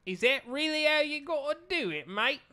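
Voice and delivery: high mock Australian, voice lifting at the end; Australian upspeak. Shown as a demonstration. Friend testing the upspeak advice, mock Australian. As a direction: try an exaggerated Australian rising tune. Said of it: "You just have to upspeak".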